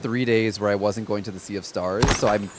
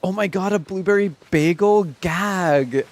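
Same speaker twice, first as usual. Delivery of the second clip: sassy gag; 6 semitones higher, slower than usual. Retold or imitated. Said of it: "I was like".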